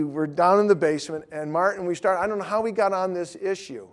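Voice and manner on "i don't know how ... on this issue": ordinary talk, nothing marked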